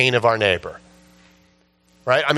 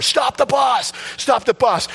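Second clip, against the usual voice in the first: high urgent shout; high desperate shout; retold yell; 11 semitones higher, slower than usual. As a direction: yell at the driver to stop now. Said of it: "yelling at the bus driver".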